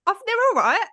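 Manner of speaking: high-pitched mock "I'm fine"; put-on reassurance. Telling co-host how they insisted the olives were fine, high mock reassurance. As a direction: retell it in a high, squeaky, unconvincing voice. Retold reluctant reply about the olives.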